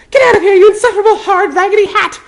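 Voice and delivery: put-on high, loud, fast furious girl; high girl voice, rapid furious shout; furious sputter. A narrator's reading. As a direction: sputter insults as fast as you can. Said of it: "Hermione sputtered furiously".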